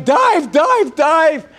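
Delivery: high, urgent mock shout; imitated shouted command. John Wayne orders the submarine crew down, acted shouting. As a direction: holler it as a repeated order. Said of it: "they all start screaming".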